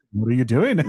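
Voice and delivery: put-on botched accent drifting into Liverpudlian. Storyteller retrying a friend's accent, landing in bad Liverpudlian.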